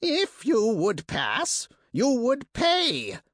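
high, slow rasping monster voice; rasping creature. Acted. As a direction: rasp it out like a greedy bird beast. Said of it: "it rasped".

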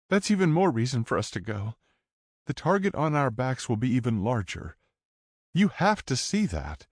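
put-on high, pleading teen girl; young woman voice. A narrator's reading.

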